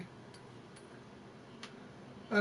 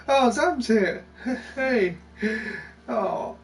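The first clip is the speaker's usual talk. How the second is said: ordinary delivery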